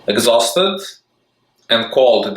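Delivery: plain reading voice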